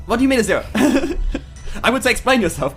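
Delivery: ordinary talk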